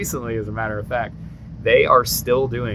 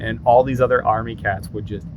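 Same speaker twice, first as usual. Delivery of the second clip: ordinary talk, nothing marked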